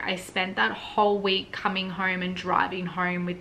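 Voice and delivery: normal talking voice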